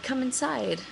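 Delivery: high soft coaxing call. Retold or imitated. Coaxing the stray cat into the house, high and soft. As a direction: sweetly coax the cat inside.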